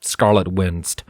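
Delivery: usual speaking voice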